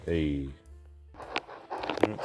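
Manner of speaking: usual speaking voice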